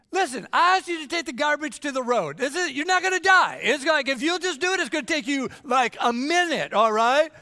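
put-on high, loud scolding of a lazy kid; exasperated parent. Parent scolding child about garbage.

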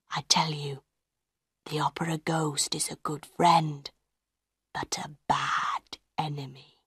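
low, hushed, near-whispered menace; quiet warning. A narrator's reading. Madame Giry warns the directors about the ghost, hushed. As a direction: sink almost to a whisper. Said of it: "she said quietly".

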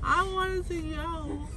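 tearful, sobbing words; crying. Real, not acted. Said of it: "Are you crying?".

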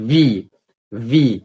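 ordinary talk

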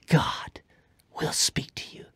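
soft hushed promise; whisper. Real, not acted.

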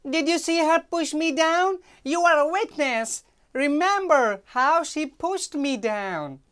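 high, loud complaining maid; indignant maid. Acted. Maid demanding the boy witness her push, loud and high. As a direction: demand he back you up.

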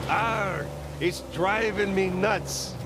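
high, drawn-out pirate punchline; joke pirate. Acted. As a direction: growl "Arr" and stretch out the punchline like a pirate.

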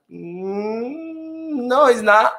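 high, drawn-out doubtful hum into a no; skeptical disagreement. Retold or imitated. Retells his doubtful reply to rumours.

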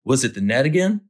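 ordinary delivery

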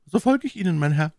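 hoch gesprochene Mylady, misstrauisch. A narrator's reading. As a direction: als misstrauische Lady, deutlich höher. Voiced Milady. (Mylady fragt den Offizier misstrauisch, hohe Stimme)

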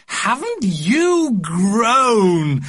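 very high, slow, drawn-out gushing aunt. A narrator's reading. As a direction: coo it like a doting aunt. Aunt gushing over nephew.

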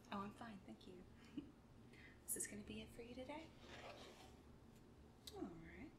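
usual speaking voice

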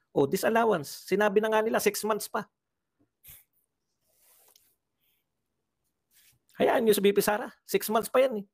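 high-pitched, exasperated protest; indignant rebuttal. Real, not acted. Commentator defending VP Sara against the disallowance charge, indignant. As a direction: argue it back with pitch jumping up.

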